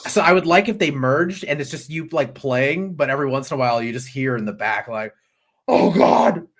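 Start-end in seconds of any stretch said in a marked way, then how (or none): 5.7-6.5 hammed-up agonized cry, rising louder; mock plague wail. Imagining a plague victim in the background, mock wailing. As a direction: pretend to wail in torment.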